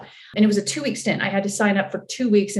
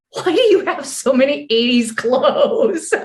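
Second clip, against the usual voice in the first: higher, slow, exaggerated bafflement; mock incredulous; 5 semitones higher, 4 dB louder than usual. Imitating a costumer eyeing an extra's wardrobe, incredulous. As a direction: draw out the astonished question, amused.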